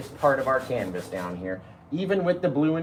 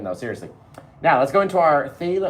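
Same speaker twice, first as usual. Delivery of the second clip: usual speaking voice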